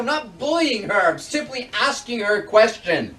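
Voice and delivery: heated, high-pitched retort; angry. Acted. Man denying to his accuser that he bullies, angry. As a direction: lash out in indignant denial.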